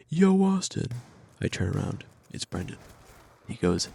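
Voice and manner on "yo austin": loud, high-pitched mock holler; high sustained shout to get attention; calling out. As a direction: imitate someone hailing you from behind. Retold call from behind him.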